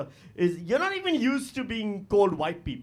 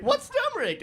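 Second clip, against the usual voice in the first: much higher, naive curious voice; high, innocent mock question; 14 semitones higher than usual. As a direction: ask it like a wide-eyed newcomer.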